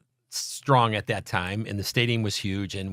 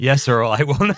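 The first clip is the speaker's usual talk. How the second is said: laughing promise; 4 semitones higher, 8 dB louder than usual. Retold or imitated. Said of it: "I said".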